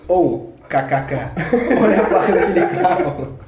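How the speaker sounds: slow, laughing through the words. Retold or imitated. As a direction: giggle it out at a stranger. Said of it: "E fiquei rindo".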